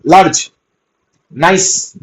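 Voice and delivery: ordinary delivery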